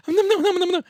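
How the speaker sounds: squeaky high nom-nom chomping; high nom noises. Retold or imitated. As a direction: chirp the noms way up high. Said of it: "I'll be like".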